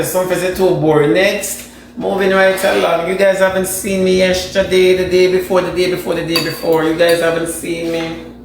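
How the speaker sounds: ordinary delivery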